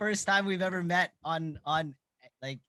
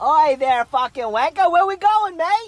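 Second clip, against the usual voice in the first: mock shout; 6 semitones higher, 9 dB louder, slower than usual. Mock greeting shouted at mate.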